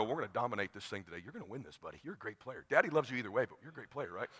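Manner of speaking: low, very soft murmured encouragement; private pep talk. Retold or imitated. Dad with his arm round his son, hushed. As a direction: keep it hushed, just for him.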